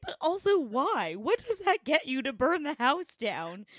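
can't believe anyone would suggest it; incredulous why. Retold or imitated. To grandmother about burning the house, incredulous. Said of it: "I'm just like".

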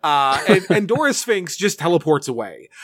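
ordinary talk, nothing marked

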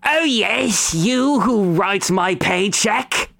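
high, slow goblin servant voice; hunchback goblin. Acted. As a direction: draw it out high, an eager goblin at attention. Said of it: "a hunchback goblin with a rumpled top hat".

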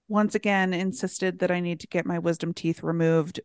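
ordinary talk, nothing marked

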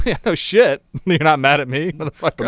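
high, loud, incredulous comeback; laughing mock outrage; mock indignant retort. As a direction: act out a scornful inner reply, half laughing. Acts out his scornful inner reply.

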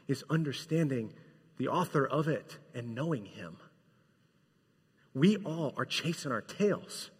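quiet preaching. Acted. Soft reflective sermon passage.

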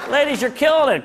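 ordinary talk, nothing marked

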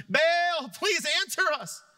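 loud, high cry with drawn-out words; shouting. Retold or imitated. Baal's prophets calling on their god, shouting high and loud. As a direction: holler a desperate plea to a false god.

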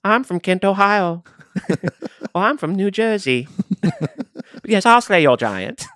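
high, hammed-up medieval British accent; medieval British mercenary. Mock giant slayer in a medieval British accent demanding his reward. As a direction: play a pompous medieval British knight for hire. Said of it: "from medieval British".

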